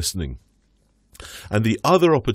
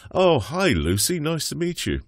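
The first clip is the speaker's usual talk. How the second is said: put-on higher, gentle hello to a small child; greeting a toddler; 6 semitones higher than usual. Shown as a demonstration. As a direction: greet the two-year-old kindly, voice lifted. Doctor greets the little girl.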